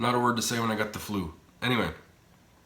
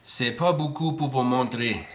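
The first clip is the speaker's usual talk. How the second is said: speaking French; 3 semitones higher than usual. Real, not acted. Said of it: "I don't know why I'm talking French".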